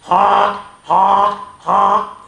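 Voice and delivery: loud honking noise; goose honk. Shown as a demonstration. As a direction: honk it out for the kids. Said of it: "You have to honk your nose".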